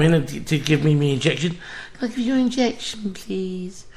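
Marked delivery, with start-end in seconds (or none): 1.9-3.9 high, small mock-timid voice; timid nurse. Patient mocking the timid ginger nurse, high and meek. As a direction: mimic a meek nurse asking politely. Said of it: "She was all timid".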